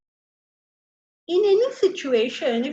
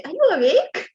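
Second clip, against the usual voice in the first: higher, louder coaxing question; sweet wake-up call; 6 semitones higher, 5 dB louder than usual. Retold or imitated. Wife nudging her sleeping husband each morning, coaxing. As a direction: call him awake gently but insistently.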